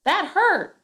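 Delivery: "That hurt" shouted high and loud, "said Tom" plain; rereading the sentence with "That hurt" shouted; yelled quote. Shown as a demonstration. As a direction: yell the words, then drop to plain for the tag. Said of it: "make sure you reread this".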